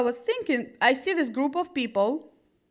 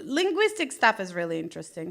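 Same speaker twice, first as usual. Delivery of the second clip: ordinary talk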